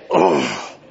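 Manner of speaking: low, drawn-out groan of annoyance; annoyed groan. Retold or imitated. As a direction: groan like a spam call just rang. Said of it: "you say".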